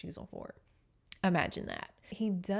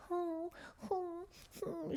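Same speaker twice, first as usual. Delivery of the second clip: mock demure humming; 10 semitones higher, 9 dB quieter, slower than usual. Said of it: "does not sit there and".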